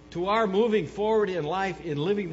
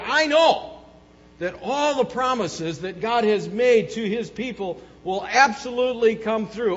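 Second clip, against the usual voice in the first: loud, higher, ringing declarations of faith; fervent pulpit proclamation; preaching at full force; 4 semitones higher, 5 dB louder than usual. Acted. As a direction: build each I know like a hammer blow.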